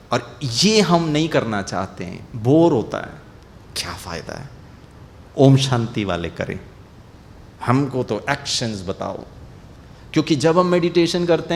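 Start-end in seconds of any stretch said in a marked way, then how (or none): 0.8-9.2 bored player. Retold or imitated. Voicing bored players' objection.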